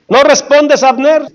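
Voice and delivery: high-pitched, lightly projected challenge; retold calling out. David calls to Abner across a great distance, mildly shouted. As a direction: call out for an answer, acting it lightly. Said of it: "dio David voces al pueblo y a Abner".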